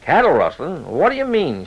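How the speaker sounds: plain reading voice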